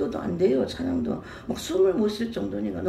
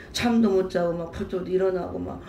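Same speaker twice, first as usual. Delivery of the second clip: ordinary delivery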